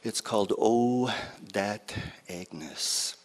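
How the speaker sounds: usual speaking voice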